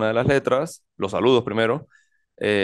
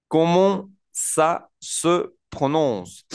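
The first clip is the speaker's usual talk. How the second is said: very slow, higher, words set apart; 5 semitones higher, much slower than usual. Shown as a demonstration. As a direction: model each French word separately for beginners. Teacher models a new French phrase.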